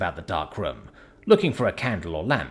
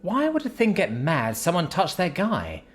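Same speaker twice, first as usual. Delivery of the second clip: lightly raised higher voice for Sapphire, teasing; Sapphire's voice; 9 semitones higher than usual. Acted. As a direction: play the sassy friend a touch higher. Sapphire explaining.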